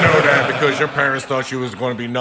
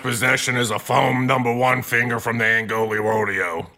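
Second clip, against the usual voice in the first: mocking impression. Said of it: "I never knew you could do such good voices".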